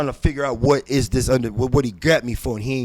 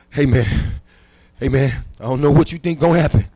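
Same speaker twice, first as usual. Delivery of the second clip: loud; 3 semitones higher, 8 dB louder than usual. Retold or imitated. Confronting cellmate, loud.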